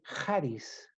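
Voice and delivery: "charis" said slowly as KHA-ris, with a rasped Spanish j at the start; "charis" slowly, with a harsh throat sound like the Spanish j; Greek word read out. Shown as a demonstration. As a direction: spell out "charis" slowly, opening with the j of "jota". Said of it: "se lee".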